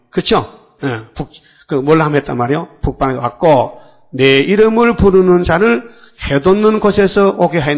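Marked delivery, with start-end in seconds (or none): 4.1-7.8 proclaimed scripture. Acted. Preacher proclaims the scripture verse.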